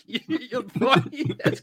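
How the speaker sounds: cracking up mid-phrase; laughing. Real, not acted. Host reading a listener comment, cracking up laughing.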